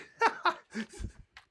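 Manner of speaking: a shrill put-on laugh; high mock cackle. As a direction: cackle high like a naughty imp. Playful blue message tease.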